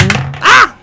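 sudden high, loud yelp of surprise; startled cry. Real, not acted. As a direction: yelp as the piece drops. Figure falls out of box.

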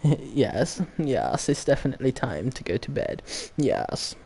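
soft mock-posh English with drawn yeses; put-on posh English accent; Queen's proper English. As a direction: play a pompous English toff winding down. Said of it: "talking in the Queen's proper English".

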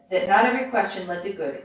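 ordinary delivery

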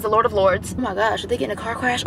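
ordinary talk

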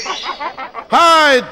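high, loud yelp; sudden panicked holler; urgent shout. Pretend. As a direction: yell it like an urgent warning. Urgent call as Peach nears.

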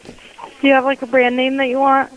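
ordinary delivery

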